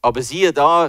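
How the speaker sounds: ordinary delivery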